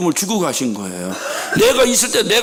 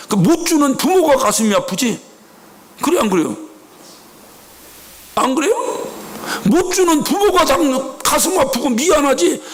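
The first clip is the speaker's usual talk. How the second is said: ordinary talk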